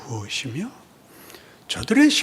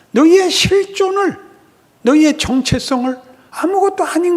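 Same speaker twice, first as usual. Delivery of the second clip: loud, much higher shouting rebuke; furious God; 12 semitones higher, 10 dB louder than usual. Acted. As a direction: thunder at them like a betrayed father. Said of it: "하나님이 꾸짖습니다".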